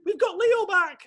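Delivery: put-on very high, excited children's cheer; imitating overjoyed kids. Children welcoming their lost cat home, overjoyed. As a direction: squeal it like thrilled kids. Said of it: "the kids are over the moon".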